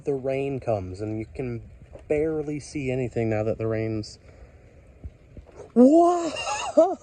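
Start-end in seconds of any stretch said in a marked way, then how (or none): 5.7-7.0 loud, high-pitched real yelp of surprise; startled shout. Real, not acted. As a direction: burst out much higher and louder. Startled by the storm while filming.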